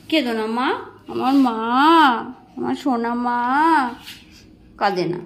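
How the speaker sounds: soothing a weeping mother, hushed; gentle soothing. Real, not acted.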